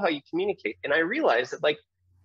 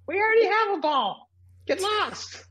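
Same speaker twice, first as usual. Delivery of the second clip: mocking kids. Kids mocking him at recess.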